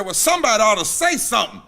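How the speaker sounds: loud, high, quick punchline; exasperated outburst. Retold or imitated. Drunk guy to silent pastor, acted exasperated outburst. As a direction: burst out with it as a retold protest.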